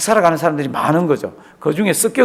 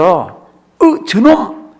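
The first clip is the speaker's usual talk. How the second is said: a sudden higher cry of recognition; startled recognition; 4 semitones higher, 3 dB louder, much slower than usual. Retold or imitated. Joseph seeing the brothers who sold him, startled. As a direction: spot them and blurt it out.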